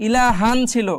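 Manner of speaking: ordinary talk, nothing marked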